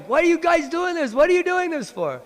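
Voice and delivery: high, loud outraged yell; yelling girls. Retold or imitated. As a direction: shout it like furious milkmaids. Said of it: "And they're yelling".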